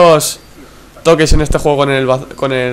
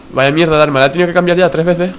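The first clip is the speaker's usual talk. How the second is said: ordinary talk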